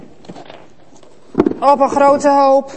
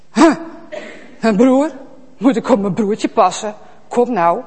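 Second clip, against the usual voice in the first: dismissive, feigned surprise; evasive Kaïn. Acted. Kaïn evading God's question, dismissive. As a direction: brush the question off.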